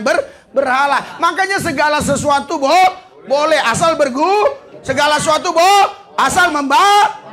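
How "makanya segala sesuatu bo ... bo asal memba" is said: raised, high, halting preacher's cry; loud stammering sermon build; fervent preaching. Real, not acted. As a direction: preach it out with fire. Preacher quoting scripture with fervour.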